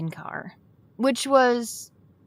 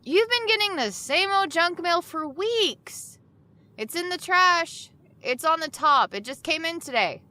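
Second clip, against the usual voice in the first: higher voice calling from the bedroom; raised high call across the apartment; 9 semitones higher than usual. Acted. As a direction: call it out to a friend in another room. Calls across the house.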